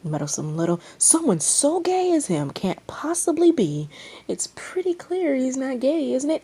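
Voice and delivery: high, loud indignant voice; heated retort. Acted. Arguing over the bet, heated and loud. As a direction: snap back in outrage.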